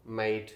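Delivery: मेड said "meid" with a gliding vowel; diphthong demo. Shown as a demonstration. Teacher modelling the diphthong in मेड. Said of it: "मेड की बजाय मेड".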